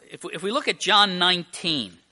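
ordinary talk, nothing marked

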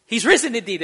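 high and loud, the proper full reply; hearty church response. Shown as a demonstration. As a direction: belt out the expected congregation answer. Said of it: "they don't go".

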